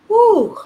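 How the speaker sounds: sudden high, loud whoop of release; excited whoop. Real, not acted. Coach whooping at viewers mid tapping, high and loud. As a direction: let out a bright cheer.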